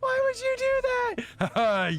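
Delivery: very high squeak of disbelief; betrayed squeal. Acted. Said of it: "He goes".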